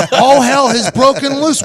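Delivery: high, loud, slowed outburst; drawn-out incredulous protest; mock exasperation. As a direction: holler it back in disbelief. His exasperated answer to coach.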